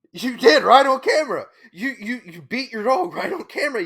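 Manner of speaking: high-pitched furious accusation; outraged yell. Real, not acted. Accusing the YouTuber of beating her dog, high outraged yell. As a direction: hurl it at the screen. Yelling back at apology clip.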